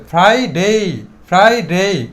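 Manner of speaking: ফ্রাইডে with the r sounded after an aspirated f; pronounced r. Shown as a demonstration. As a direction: sound the r in ফ্রাইডে, long "dei" at the end. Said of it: "আরটা উচ্চারিত হবে". (ফ্রাইডে-র "র" উচ্চারিত, শেষে "ডেই")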